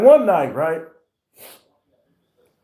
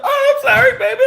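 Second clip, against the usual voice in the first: much higher, laughing, sing-song sorry; mock girly apology; faster than usual. Man mimicking girlfriend's apology in a high mocking voice. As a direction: ham up her sweet apology.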